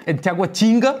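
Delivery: high, put-on Brazilian accent. Presenter playing at being a local, Brazilian accent. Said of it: "imitar exactamente el acento brasileño".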